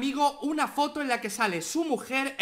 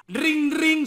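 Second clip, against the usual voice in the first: phone ring imitation; 6 semitones higher, 6 dB louder than usual. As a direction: ring ring through a laugh. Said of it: "sonido de teléfono". (imitando un teléfono)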